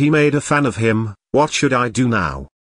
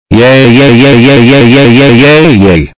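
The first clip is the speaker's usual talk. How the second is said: loud repeated cheers; loud cheering; 15 dB louder than usual. Acted. Kid cheering in his rant video, loud chant. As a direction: cheer at the top of your lungs.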